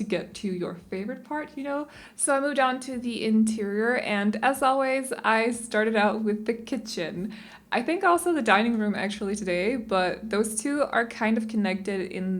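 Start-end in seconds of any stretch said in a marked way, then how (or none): none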